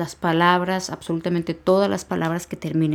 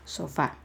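"sofá" stressed on FÁ, said softly; soft, low "sofá" leaning on the final á; stress on last syllable; 3 semitones lower, 9 dB quieter than usual. Shown as a demonstration. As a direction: quietly correct it to so-FÁ in "sofá". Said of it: "esa es la última sílaba".

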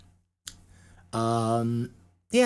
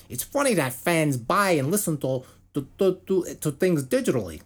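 put-on Minnesota accent; 4 semitones higher than usual. Podcaster reading bassist [name]'s quote, mock Minnesota accent. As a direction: put on a shaky attempt at Minnesotan. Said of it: "Is that how a guy from Minnesota talks?".

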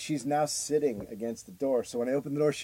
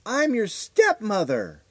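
slow, drawn-out, higher creepy announcement; eerie stepmother; 8 semitones higher, 4 dB louder, much slower than usual. Retold or imitated. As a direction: stretch it out like a strange visitor. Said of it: "she goes".

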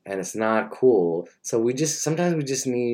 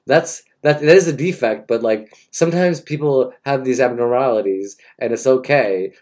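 a loud, hammed-up earnest rant; loud mock sermon; 4 semitones higher, 8 dB louder than usual. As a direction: preach it loudly for laughs.

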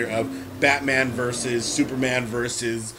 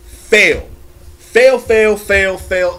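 emphatic rant; 4 semitones higher, 9 dB louder, much slower than usual. Real, not acted. Ranting against movie twist.

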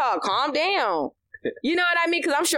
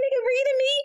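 mock outrage; much faster than usual. Mock indignant reply.

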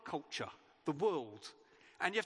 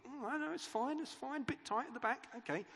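high, breezy reassurance; reassuring partner; 7 semitones higher than usual. Pretend. Spouse reassuring about a tight outfit, high and breezy. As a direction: brush it off lightly with a high voice.

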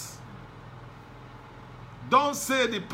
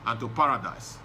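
Jesus, lower, gently incredulous; 6 semitones lower, 3 dB quieter than usual. Retold or imitated. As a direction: lower voice of Jesus, mildly amazed. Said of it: "Oh, Jesus said".

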